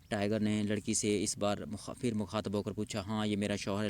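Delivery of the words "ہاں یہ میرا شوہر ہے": ordinary delivery, nothing marked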